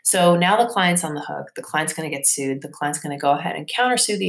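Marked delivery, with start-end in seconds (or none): none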